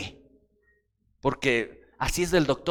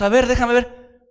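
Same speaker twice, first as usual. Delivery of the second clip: mock doctor; 6 semitones higher, 5 dB louder than usual. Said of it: "llega el quiropráctico".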